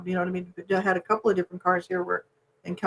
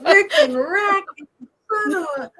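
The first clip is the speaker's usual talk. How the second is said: high, drawn-out mock swearing; retold curse, stretched out and laughing; cussing through laughter; 10 semitones higher, slower than usual. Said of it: "cussing and laughing all at the same time".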